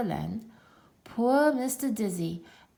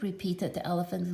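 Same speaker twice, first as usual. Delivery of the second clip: ordinary delivery, nothing marked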